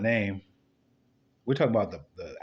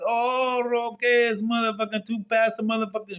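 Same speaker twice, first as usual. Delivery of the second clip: mock local voice; 10 semitones higher than usual. Mocked Mexican man answers.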